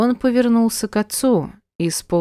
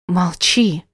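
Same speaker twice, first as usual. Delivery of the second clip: hissing. Acted. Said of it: "зашипела".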